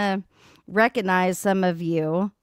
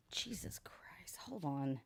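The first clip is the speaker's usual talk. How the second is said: whispered curse; 4 semitones lower, 21 dB quieter, slower than usual. Real, not acted. Dog chaos, muttered under breath.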